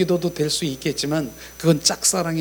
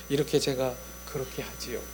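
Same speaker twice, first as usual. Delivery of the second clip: soft inner prayer; 5 semitones lower, 9 dB quieter than usual. Shown as a demonstration. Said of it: "여기다 대고 이렇게 소".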